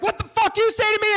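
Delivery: high, loud furious challenge; imitating a raging street performer; enraged yell. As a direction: explode at someone in rage. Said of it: "all of the rage he had".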